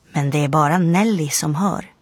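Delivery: usual speaking voice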